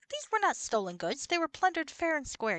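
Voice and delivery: loud, high-pitched indignation; indignant protest. Real, not acted. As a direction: protest loudly at being wrongly accused. Protesting the shop's refusal to buy loot.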